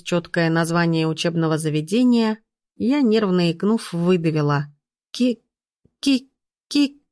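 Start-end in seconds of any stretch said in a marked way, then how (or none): 5.1-7.0 nervous, hiccupping stutter on one syllable; stuck stammer. A narrator's reading. Student reading her assigned academy, stammering nervously. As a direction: jam on the first syllable, pausing between tries. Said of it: "Кажется, меня заклинило".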